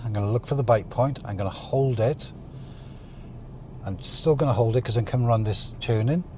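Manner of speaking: ordinary talk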